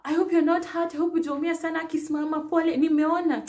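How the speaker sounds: high, fussing voice of a kind passer-by; concerned stranger. Retold or imitated. Passer-by helping a fallen woman up, anxious and soothing. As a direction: fuss over her like a worried stranger. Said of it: "this lady's like".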